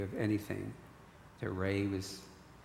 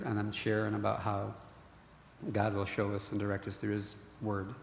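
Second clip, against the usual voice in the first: usual speaking voice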